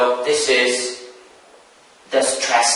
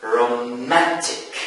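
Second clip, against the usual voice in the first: "romantic" slow, leaning on "man"; stress on second syllable; 2 semitones lower, 4 dB quieter than usual. Shown as a demonstration. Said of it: "The stress falls on the second syllable".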